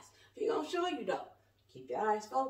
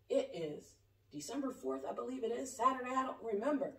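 ordinary talk

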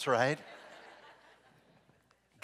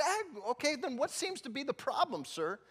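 higher, measured officer; polite cop; 13 semitones higher than usual. Retold or imitated. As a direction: ask the question politely. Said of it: "the cop's like".

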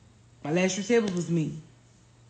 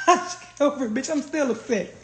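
chuckling through the words; amused, half-laughing confession; 4 semitones higher than usual. Real, not acted. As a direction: laugh while admitting the grudge. Laughing at own grudge.

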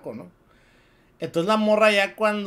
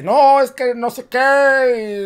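higher, louder, slow dodging excuse; evasive dad; 7 semitones higher, 7 dB louder, much slower than usual. Acted. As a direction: stall and dodge like a guilty father. Papá se excusa evasivo en historia. (papá evasivo, más agudo, fuerte y lento)

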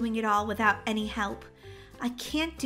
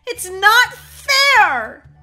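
loud, drawn-out wail of protest; fed-up outburst; 5 semitones higher, 12 dB louder, much slower than usual. Acted. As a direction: cry out at the unfairness, slowly. Mom's outburst about endless chores.

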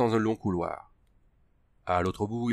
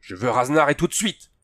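higher, louder angry command; barking order; 5 semitones higher, 5 dB louder than usual. A narrator's reading. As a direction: bark it at the priest. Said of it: "aboya Andrew".

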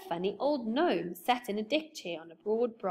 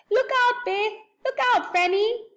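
much higher and louder shout of warning; urgent yell; 14 semitones higher, 9 dB louder than usual. Acted. Joe shouting a warning to Beth and Franny, high and loud. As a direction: yell it up high to warn them. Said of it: "he yelled".

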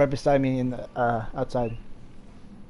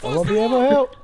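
higher, louder mocking whine; silly high voice; 10 semitones higher, 5 dB louder, faster than usual. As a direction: squeak it back as a joke. Line repeated in silly voice.

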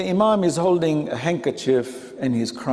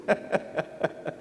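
short airy chuckle; a quiet breath of a laugh; soft breathy laugh; 2 semitones higher, 9 dB quieter than usual. Real, not acted. Laughing at his own example.

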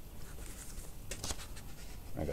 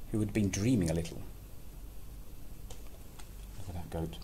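plain reading voice

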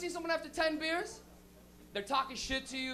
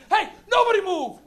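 loud, high police order; cop shout; 7 semitones higher, 13 dB louder than usual. Acted. Cop bursting in on a room of smokers, commanding shout.

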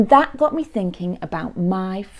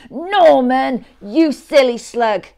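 loud, higher-pitched scolding; crying out crossly; 7 semitones higher, 10 dB louder, slower than usual. Acted. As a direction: call out as annoyed snails telling off a slug. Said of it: "they cried".

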